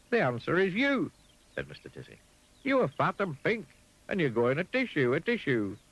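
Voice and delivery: high and brightly sure; high Mister Dizzy. Acted. Mister Dizzy answers the pig, high and sure. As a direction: answer with easy confidence.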